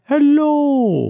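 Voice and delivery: high, drawn-out mock hello; sitcom-style mock greeting; Seinfeld-style hello. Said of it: "Might be a Seinfeld thing".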